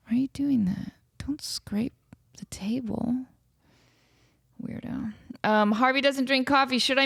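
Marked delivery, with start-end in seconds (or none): 0.1-5.1 whispered scolding, off mic; whispered aside. Real, not acted. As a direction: hiss it quietly to someone nearby. Hushed aside scolding someone.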